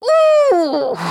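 long, high, loud furious "ooh"; exasperated high-pitched groan; rasped growl of frustration. A narrator's reading. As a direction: squeal through gritted teeth. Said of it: "rasped Clorinda between gritted teeth".

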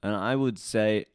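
usual speaking voice

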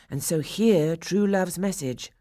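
usual speaking voice

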